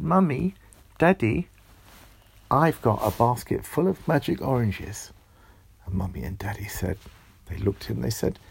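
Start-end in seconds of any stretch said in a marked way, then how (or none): 0.0-5.1 put-on high, loud, eager child calling out to her parents; excited child calling. Amelia calls her parents, bursting with excitement. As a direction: call out like an excited little girl.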